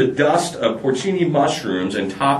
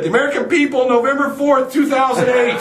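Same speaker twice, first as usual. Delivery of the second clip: much higher triumphant schoolboy shout; shouted punchline; 13 semitones higher, 3 dB louder than usual. Acted. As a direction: nail the final punchline with glee.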